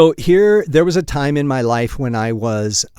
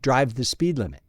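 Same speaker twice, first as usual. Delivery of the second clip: ordinary delivery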